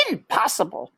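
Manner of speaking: put-on high, fussy old aunt voice; Aunt Florence. A narrator's reading. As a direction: squeak it out like a prim old aunt mouse. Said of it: "said Aunt Florence".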